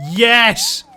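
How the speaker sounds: loud, high burst of joy; triumphant cheer. Real, not acted. Player feeds carbon to the creature, triumphant cheer. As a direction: shout it out in triumph. Feeding the new creature works.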